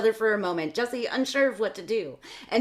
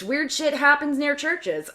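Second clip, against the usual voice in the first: ordinary talk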